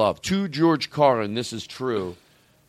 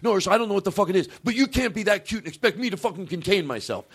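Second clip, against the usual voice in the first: fast, higher overexcited rant; fast, higher gushing at a cute dog; gushing mock rant; 7 semitones higher, faster than usual. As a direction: rattle it off fast at the dog, overwhelmed by cuteness. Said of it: "Even if it was yelling at her".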